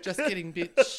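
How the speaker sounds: loud, high words through laughter; laughing. Real, not acted. Capping a friend's celebrity story, laughing. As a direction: crack up on the punchline. Joking along while laughing.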